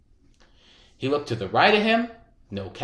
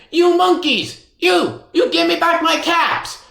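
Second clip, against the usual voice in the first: much higher, louder angry shout; angry peddler; 13 semitones higher, 8 dB louder than usual. A narrator's reading. Angry peddler shouts at the monkeys, high and loud. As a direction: lose his temper at them. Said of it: "This made the peddler angry".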